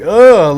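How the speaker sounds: loud, high mock "ugh" of disgust; disgusted groan. Imitating offended viewers reacting to his jokes, loud mock "ugh". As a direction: groan like a cringing audience member.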